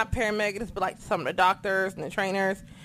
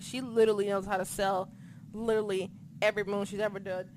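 ordinary talk, nothing marked

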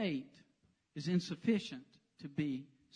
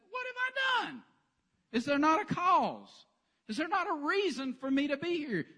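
indignant comeback; 5 semitones higher, 3 dB louder than usual. Pretend. David sassing his brother in a high indignant whine. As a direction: protest it like a wronged kid with sass. Imagined sassy David retort.

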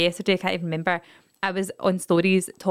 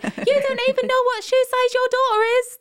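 very high mock-outraged shriek; outraged mum. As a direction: imitate a mum scolding a deadbeat dad, pitched way up. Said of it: "the mum will go like".

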